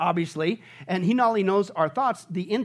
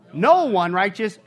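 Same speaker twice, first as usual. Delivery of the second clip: slow and louder, each word weighted; emphatic slow quote; 5 dB louder, slower than usual. Real, not acted. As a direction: lean on every word. Quoting Romans emphatically.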